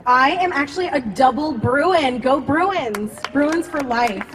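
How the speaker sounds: ordinary delivery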